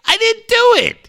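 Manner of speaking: high, defensive protest; whiny protest. Retold or imitated. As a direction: driver protesting innocence to angry texters, defensive. Protesting to texters.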